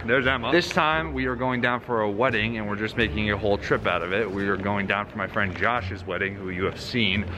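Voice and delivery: normal talking voice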